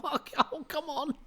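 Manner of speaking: high, incredulous "What? Oh, come on"; exasperated disbelief. Retold or imitated. Interviewer exasperated by the guest's vague reply, incredulous and high. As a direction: throw up your hands at his vagueness. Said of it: "And I was just like".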